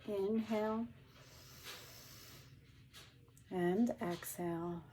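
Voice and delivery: slow, drawn-out breathing prompts; slow breathing cues. Shown as a demonstration. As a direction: pace each cue with the breath.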